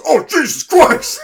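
high, loud mock yell of alarm; demon shouting. Demon startled as she raises the needle, loud high yell. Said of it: "and the demon goes".

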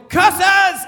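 high, loud shout at the moon; yelled curse. Retold or imitated. Dad yelling at the moon after hitting his shin. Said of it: "raised his fists and just yelled".

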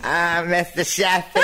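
a high Fran Drescher squawk; Fran Drescher impression. Asked for Fran Drescher, a high nanny voice. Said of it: "what about Fran Drescher?".

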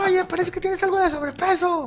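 high, laughing prissy voice; high fake-courteous jab; mock polite heckler. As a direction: deliver the insult far too politely. Mimicking polite fan insult.

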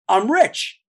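retold giddy announcement; naive delight. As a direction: declare it like a thrilled rookie. Retold naive delight at small salary.

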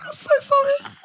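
high apology through giggles; helpless laughter. Real, not acted. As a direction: squeak out sorry while cracking up. Still helpless with laughter while apologising.

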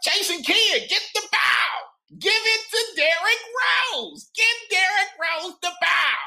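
high, far-off squeaky coach shouting plays; excited coach impression barking at his players; Avery Johnson voice. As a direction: yell the play call like Avery Johnson, way up high. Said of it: "in an Avery Johnson voice".

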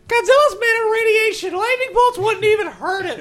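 put-on high-pitched, loud childish outrage; yelling at TV. Seven-year-old Godzilla fan arguing with the TV, yelling. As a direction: reenact a little kid hollering in protest. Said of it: "I'm literally yelling at the T V".

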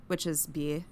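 usual speaking voice

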